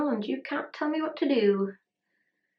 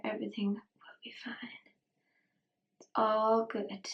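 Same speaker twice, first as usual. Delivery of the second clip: soft reassuring whisper; 8 dB quieter than usual. Real, not acted. Whispering reassurance to the eyeshadow on her lid.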